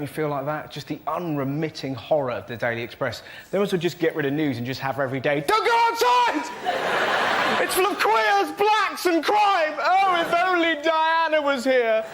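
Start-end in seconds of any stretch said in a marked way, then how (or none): 5.5-12.0 high, loud, drawn-out wail; hammed-up hysterical warning; panicked alarmism. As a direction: shriek the headlines in mock dread. Newspaper acted as panicked alarmist.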